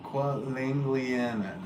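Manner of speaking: "Qualangliana" stretched out slowly, every syllable given its own weight; slow careful try. Real, not acted. Streamer trying a viewer's name again, slow and unsure. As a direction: take it slowly, testing "Qualangliana" one piece at a time. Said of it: "I'm butchering your name".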